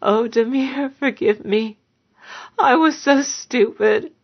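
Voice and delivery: high, tearful plea to the dead husband; weeping. A narrator's reading. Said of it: "Vasha began to weep".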